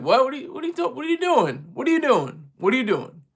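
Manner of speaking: mimicking someone thrown by a caller; mock bewilderment. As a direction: repeat it as a puzzled, lightly exasperated interruption. Quotes host reacting to him.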